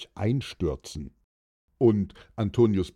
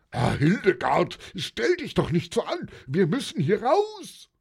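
high-pitched agitated yell; panicked bellow; 10 semitones higher than usual. A narrator's reading. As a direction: bellow in exasperation, voice climbing high. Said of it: "Der brüllte".

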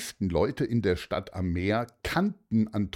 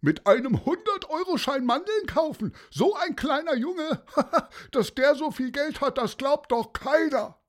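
very high, incredulous mockery; high-pitched jeering onlooker; scornful disbelief. A narrator's reading. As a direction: scoff with a derisive laugh. Onlooker mocks boy's claim.

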